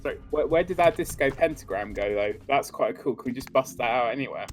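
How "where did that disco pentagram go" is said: ordinary talk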